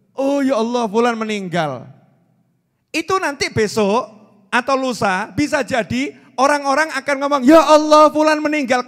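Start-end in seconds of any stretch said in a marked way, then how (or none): 0.1-1.9 louder, higher gasp of bad news; mock shocked mourner; shocked exclamation. Imitating shocked reader again. 7.4-8.9 much louder, higher cry of grief; hammed-up mourning cry; loud shocked wail. Imitating people hearing your death.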